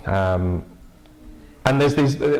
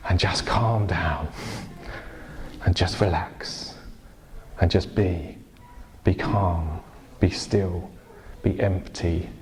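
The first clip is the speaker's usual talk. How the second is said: slow, lower, hushed invitation to stillness; gentle contemplative preaching; 4 semitones lower, 5 dB quieter, slower than usual. Real, not acted. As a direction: ease them into calm, with long pauses.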